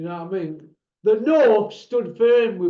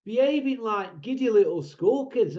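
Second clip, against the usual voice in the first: ordinary talk, nothing marked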